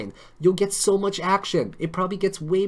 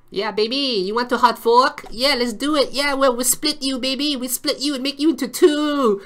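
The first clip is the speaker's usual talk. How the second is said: higher and louder, building to a squeaky cartoon tone; goofy over-the-top come-on; silly flirt voice; 8 semitones higher, 7 dB louder than usual. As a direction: ham up the seductive banter. Acting out silly flirting dialogue.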